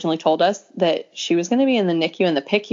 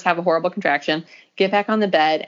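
ordinary delivery

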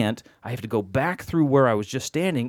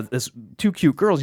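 ordinary talk

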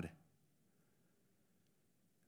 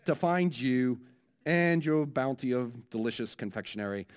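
hammed-up New Zealand preacher praying; New Zealand accent; 4 semitones higher than usual. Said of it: "my New Zealand accent".

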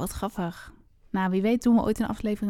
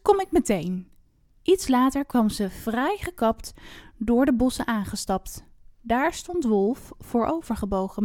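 expressive rhymed fairy-tale reading; story narration; 2 semitones higher, 4 dB louder than usual. A narrator's reading. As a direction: read it out like a storyteller, phrase by phrase. Reads a rhymed fairy tale aloud. (sprookje voorlezen, verhalend)

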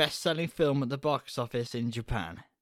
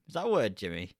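usual speaking voice

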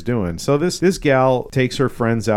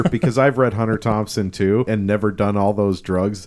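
ordinary talk, nothing marked

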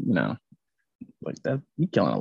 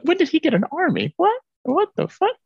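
stunned disbelief. Retold or imitated. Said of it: "I was like".

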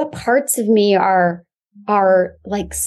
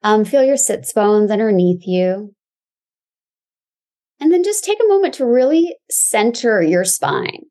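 calm grounding exercise. Acted. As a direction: guide them gently into the body.

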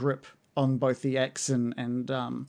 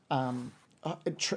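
ordinary delivery

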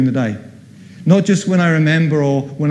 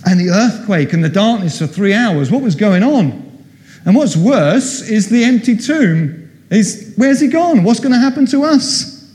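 higher-pitched, worried questioning; mildly panicked, pitch climbing as the questions pile up; anxious disciples; 6 semitones higher than usual. Acted. Disciples fretting to each other after the empty tomb, anxious.

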